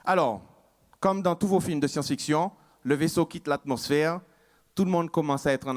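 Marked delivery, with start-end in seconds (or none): none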